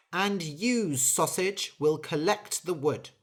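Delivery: firm angry orders. Acted. Angry bird ordering the mouse and sausage to swap jobs. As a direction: lay down the law.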